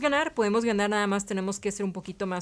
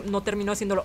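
ordinary talk, nothing marked

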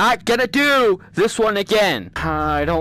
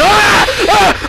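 high loud scream; real startled scream; scream of fright; 9 semitones higher, 6 dB louder than usual. Real, not acted. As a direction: jumpscare shriek. Jump scare in game.

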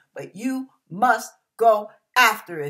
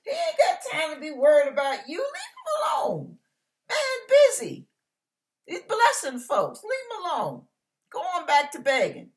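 high-pitched mock scolding; squealed, hammed-up fussing; scolding crowd; 9 semitones higher than usual. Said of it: "Those people".

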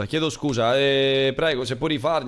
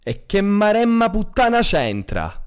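loud, slow exasperated curse; exasperated outburst; 8 semitones higher, 4 dB louder, slower than usual. Acted. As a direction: blow up in exasperation. (sbotto esasperato)

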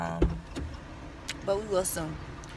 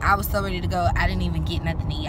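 normal talking voice

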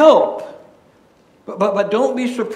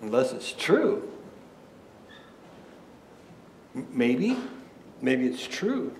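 hushed; 4 semitones lower, 10 dB quieter than usual. Real, not acted. Quiet musing turn in sermon.

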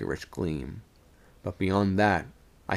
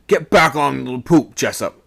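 loud, raised command; angry order; 5 semitones higher, 9 dB louder than usual. A narrator's reading. Second mate ordering sailor back, angry command. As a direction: cut off the excuse with an order.

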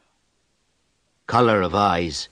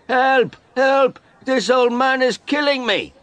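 very high, loud cries for help; shouting for help; 6 dB louder than usual. A narrator's reading. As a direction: scream it out as if being beaten. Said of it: "He shouted".

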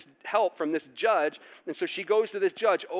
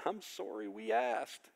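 sheepish regret; 4 semitones higher, 8 dB quieter than usual. Retold or imitated. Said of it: "the disciples are going".

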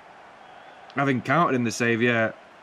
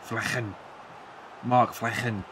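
mock Dutch accent; 6 dB quieter, slower than usual. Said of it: "why I'm doing that voice".

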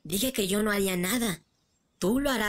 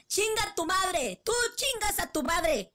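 angry high insult; 11 semitones higher, 3 dB quieter, slower than usual. Acted. Fandub characters screaming insults.

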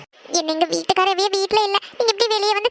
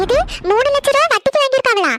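shocked; 5 semitones higher, 3 dB louder than usual. Acted. Shocked at the three lakh loan.

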